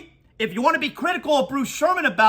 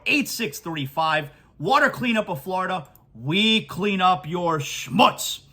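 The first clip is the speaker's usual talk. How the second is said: lower, smooth radio pitch voice; live ad read; 6 semitones lower than usual. Acted. Host reading a sponsor spot for water cleanup, smooth pitch.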